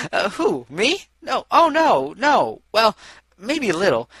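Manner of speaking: high, loud flustered fumbling; nervous denial. A narrator's reading. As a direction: deny it in a panicky rush. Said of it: "chuckled nervously".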